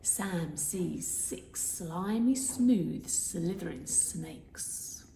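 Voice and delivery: slow, drawn-out hissing s on every word; hissing s sounds. Shown as a demonstration. Teacher hissing the s sounds like a snake. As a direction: hiss each s like a snake. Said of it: "makes it sound like a snake".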